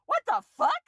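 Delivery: shrieked high and loud in disbelief; a sudden high yelp of outrage; shocked outburst. Real, not acted. Outraged at the character's choice.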